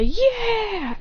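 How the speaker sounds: high, drawn-out cheer; group cheer. Acted. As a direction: let out a long, eager yeah. Group cheers as they head off.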